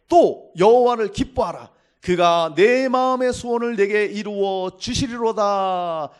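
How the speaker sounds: slow, loud, solemn recitation of the verse; scripture reading. Acted. Pastor proclaims the psalm to his congregation, slow and loud.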